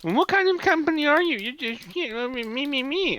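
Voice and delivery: high, mocking whiny customer voice; mock the rude caller's complaining; pissy customer. Mimicking the rude caller at the agent, high mocking whine. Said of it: "He started like".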